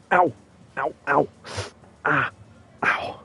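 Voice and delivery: sharp cries of pain. Real, not acted. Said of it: "Sorry, just moving again".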